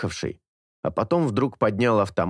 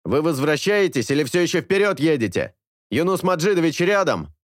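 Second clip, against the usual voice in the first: higher, louder voice over the radio; walkie-talkie voice; 8 semitones higher, 3 dB louder than usual. A narrator's reading. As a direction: call through a crackling radio.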